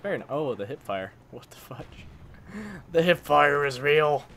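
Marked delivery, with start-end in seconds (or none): 1.3-2.1 breathless whispered disbelief; stunned whisper. Real, not acted. Stunned muttering at game. 2.1-2.9 breathy whispered laugh. Real, not acted. Laughs at hip fire kill. 2.9-4.2 loud, slow, amazed declaration; excited exclamation. Real, not acted.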